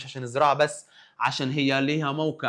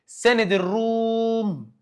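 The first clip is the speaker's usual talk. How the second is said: سند الروم drawn out, a touch higher; slow emphasis; 6 semitones higher, much slower than usual. Shown as a demonstration. Teacher to class, stressing the Romans' ally slowly. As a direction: stretch سند الروم like a headline. Teacher announces key fact.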